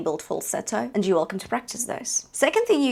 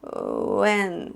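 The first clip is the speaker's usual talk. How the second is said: vocal fry; 3 semitones lower than usual. Shown as a demonstration. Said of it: "that's your fry".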